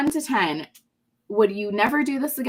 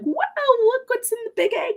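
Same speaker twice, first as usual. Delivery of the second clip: put-on high, fast excited kid; 14 semitones higher, 3 dB louder, faster than usual. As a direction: squeal like a thrilled child. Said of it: "their kids are like".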